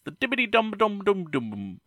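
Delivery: high, falling sing-song gibberish; playful nonsense. Pretend. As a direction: tumble playfully down the silly syllables. Plays nonsense on the title.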